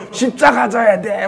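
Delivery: high, loud, drawn-out mock preaching shout. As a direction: imitate a preacher thundering a demand, slowly. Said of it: "막 이러고 있으니". (높고 크게 늘여 외치는 설교 흉내)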